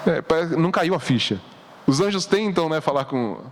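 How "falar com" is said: ordinary talk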